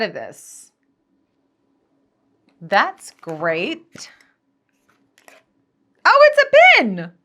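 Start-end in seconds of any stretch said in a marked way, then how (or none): 6.0-7.1 high, loud squeal of delight; light up at the gift; delighted surprise. Real, not acted. Opening a gift box for viewers, delighted surprise.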